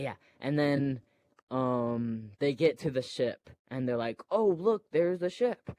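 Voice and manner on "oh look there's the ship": high, eager oh look; hammed-up discovery; mock excited. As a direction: point it out like a thrilled kid. Characters spot the ship.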